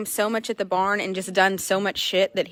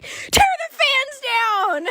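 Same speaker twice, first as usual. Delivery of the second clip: much higher, slow diva command; demanding horse; much slower than usual. Pretend. Owner voices the spoiled horse ordering, high and slow. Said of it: "And he was like".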